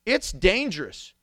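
ordinary talk

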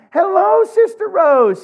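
sweet church greeting. Retold or imitated. Pastor mimics his mom greeting churchgoers sweetly. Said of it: "she'd be like".

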